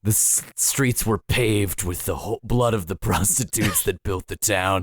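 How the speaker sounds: very deep, fast rambling voice; Jellyfish voice. Retold or imitated. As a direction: drop way down and ramble quickly. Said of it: "It's quite deep".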